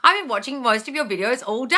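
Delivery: ordinary delivery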